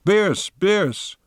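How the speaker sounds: high, calling out in alarm; anguished cry. Acted. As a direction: cry his name as he burns. Friends cry out at death.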